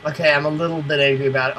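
usual speaking voice

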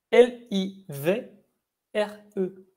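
livre spelled slowly, letter by letter; slow spelling. Shown as a demonstration. Teacher spelling livre slowly to students. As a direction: spell out each letter for the class. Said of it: "spelling livre".

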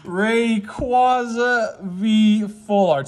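slow, high and loud, stretching every word; drawn-out excited announcement. Real, not acted. Announcing the Rayquaza pull to viewers, slow high triumphant call. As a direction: let the name ring out slowly like a prize.

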